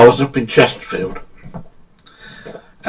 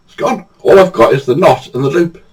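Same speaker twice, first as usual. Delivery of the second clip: ordinary talk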